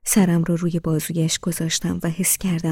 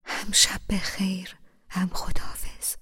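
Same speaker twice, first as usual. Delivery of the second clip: whispered. A narrator's reading. Husband whispers goodnight into wife's ear, hushed. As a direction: breathe it into her ear. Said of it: "توی گوشم زمزمه کرد".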